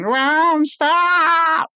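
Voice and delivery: loud, very high, long-held "stop"; mock screaming. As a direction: let rip an imitated drawn-out shriek. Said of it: "still fucking screaming at each other".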